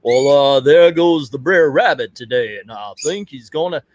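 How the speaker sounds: put-on Southern storyteller accent. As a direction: imitate a cartoonish Southern accent. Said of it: "is the accents".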